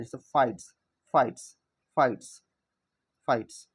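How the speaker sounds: फाइट्स repeated loud with a long AI vowel; long I sound. Shown as a demonstration. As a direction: say फाइट्स with a clear AI. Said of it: "एक ही तरह का मतलब इसको करना है".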